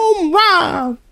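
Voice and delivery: high excited home run call; sports announcer. Pretend. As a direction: belt it like a radio announcer. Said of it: "announcer voice".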